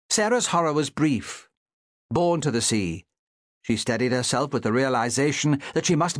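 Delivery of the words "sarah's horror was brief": plain reading voice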